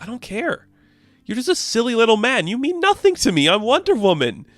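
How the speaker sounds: high-pitched, taunting putdown; mock-haughty defiance; defiant dismissal. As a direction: belittle him in an acted-out high voice. She defiantly dismisses Zeus.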